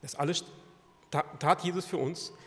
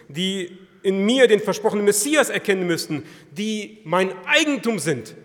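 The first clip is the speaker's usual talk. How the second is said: loud, raised, mounting indictment; impassioned preaching; 5 semitones higher, 10 dB louder than usual. Acted. Preacher voices Jesus' charge to Pilate, impassioned. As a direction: build the charge with full force.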